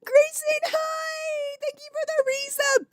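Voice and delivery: very high, squeaky laugh-choked greeting; helpless laughing squeal. Real, not acted. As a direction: squeal thanks through a laughing fit. Greets viewer mid laughing fit.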